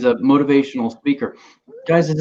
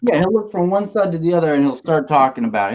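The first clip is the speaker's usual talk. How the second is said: ordinary talk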